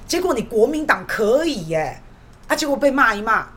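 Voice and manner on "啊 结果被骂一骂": usual speaking voice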